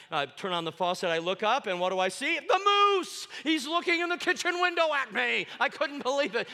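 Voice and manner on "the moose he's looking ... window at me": high, loud amazed cry; high, loud shock at the window; excited exclaim. Retold or imitated. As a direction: gasp it out in disbelief. Moose at kitchen window.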